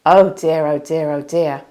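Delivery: loud, anxious fretting; fretful oh dear. Acted. Minna frets aloud over her rival's arrival. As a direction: wail it out in a fluster. Minna panics, runs downstairs.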